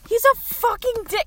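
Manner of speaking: ordinary talk, nothing marked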